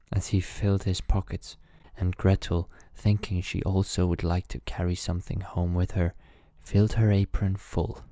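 ordinary delivery, nothing marked

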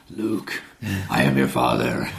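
very deep, drawn-out villain voice; Darth Vader. Shown as a demonstration. Coach demonstrating Earth as villain revealing fatherhood, very deep. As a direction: drop to the very bottom of the register. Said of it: "That's Darth Vader".

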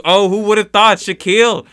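excited announcer. Acted. Improvised sportscast, surprise reveal.

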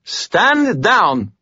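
high, authoritative order; confident officer's command, pitched high; ringing command. A narrator's reading. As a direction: give an order that will be obeyed. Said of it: "That voice rang with authority and confidence".

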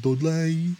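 exaggerated Dudley-accent name. Shown as a demonstration. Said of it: "done him with a Dudley accent".

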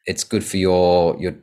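ordinary talk, nothing marked

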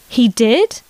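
plain reading voice

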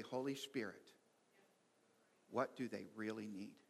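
low, soft, measured self-talk; calm prayer. Acted. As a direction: pray quietly under pressure, refusing to react. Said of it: "in such a calm manner".

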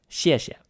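谢谢 falling on the first, light on the second; fourth tone, no tone. Shown as a demonstration. Co-host showing how to say thank you, fourth tone then toneless. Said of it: "Fourth tone, no tone".

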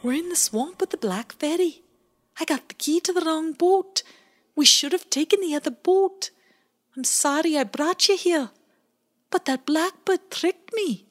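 put-on high, worried little boy voice; a frightened small boy apologizing; high sorry little voice; Bowie's high small voice. A narrator's reading. Bowie character voice.

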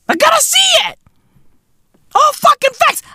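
high excited shout; excited yelp. Real, not acted. Vlogger thrilled to watch the feud, high shout.

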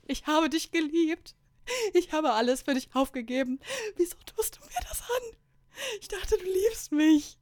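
loud, high-pitched sobbing howl; hammed-up wailing. A narrator's reading. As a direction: wail in exaggerated heartbreak, drawing the words out. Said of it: "jaule ich ins Leere". (theatralisch weinerlich gejault)

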